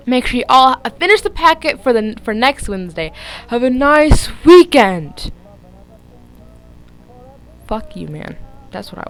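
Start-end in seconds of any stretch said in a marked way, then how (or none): 0.1-5.3 lightly voiced stern teacher; bored teacher dismissing class. Voicing the teacher.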